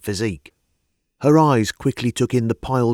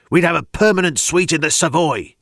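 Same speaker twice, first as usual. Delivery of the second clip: raised, louder indignant rant; the governor rails at his rival's fortune, louder; indignant outburst; 8 semitones higher, 5 dB louder than usual. Acted. Governor fuming to Barnett, louder indignant. Governor raging about Holmes.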